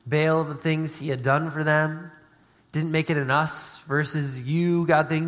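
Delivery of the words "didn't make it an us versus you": ordinary talk, nothing marked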